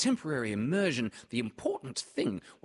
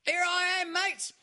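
very high, excited squeak of a little kangaroo; squeaky Roo; 4 dB louder than usual. A narrator's reading. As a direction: squeal it out like a proud little joey. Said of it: "squeaked Roo".

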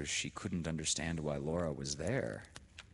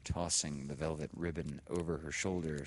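plain reading voice

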